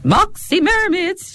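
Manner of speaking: put-on high, drawn-out Limerick City accent. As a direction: imitate a thick Limerick shop girl, stretched out. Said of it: "has a real Limerick accent".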